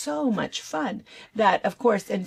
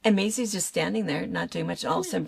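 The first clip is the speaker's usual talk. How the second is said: ordinary delivery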